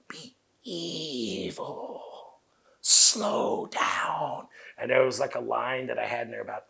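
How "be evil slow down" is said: slow, breathy mock evil; a hint of a villain's slow menace. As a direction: drag it out like a lightly voiced villain. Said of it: "No, do it again, slow it down".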